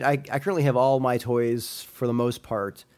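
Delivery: ordinary talk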